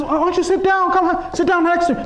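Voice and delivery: high-pitched, pressing mock invitation; insistent invitation. Imam urging the nervous messenger to sit, insistently inviting. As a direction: coax him to take a seat.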